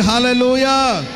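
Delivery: drawn-out hallelujah cry; loud rallying call. Real, not acted. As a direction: rouse the congregation with a long, ringing call. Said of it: "చెప్పండి గట్టిగా". (గట్టిగా, సాగదీసిన నినాదం)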